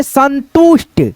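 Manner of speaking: slow, careful संतुष्ट; slow clear demo. Shown as a demonstration. Teacher modelling the word slowly for pupils. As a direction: draw out संतुष्ट for the class. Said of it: "ऐसे करके".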